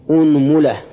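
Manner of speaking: "unmula", opening on u, u again after m; damma on both. Shown as a demonstration. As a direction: say أنملة as "unmula", u after the hamza and the m. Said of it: "ضم الهمزة". (أنملة بضم الهمزة وضم الميم)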